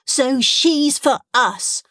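put-on high, drawn-out childish insistence; insistent child. A narrator's reading. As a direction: insist slowly in a small, high voice. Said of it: "insisted Stront".

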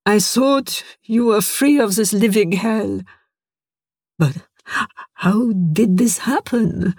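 lightly voiced starving scientist, grim; haunted survivor. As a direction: tell the tale like a broken man. Horst voiced by narrator.

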